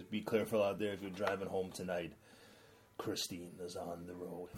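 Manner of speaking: trailer voice. Retold or imitated. Quoting film trailer voiceover.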